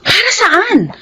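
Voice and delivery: high, sharp questions of disbelief; surprised challenge. Acted. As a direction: demand to know why. Wife reacting to search plan. (asawang nagulat at nagtatanong, mataas at matalas)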